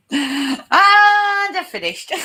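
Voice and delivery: high, long drawn-out opening word; mock climax. Friend joking over actor's picture, high hammed-up swoon. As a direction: stretch the first word out high, then drop.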